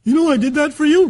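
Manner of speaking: high, loud mock outburst; terrible, angry way. Partner claiming credit in a broken relationship, mock-angry shout. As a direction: throw the favor in their face, shouting. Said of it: "do them in terrible ways".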